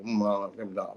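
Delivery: low, soft mumbled noises; mumbling like Boomhauer. Retold or imitated. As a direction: mumble like an old man. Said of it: "a little accent where he talk like".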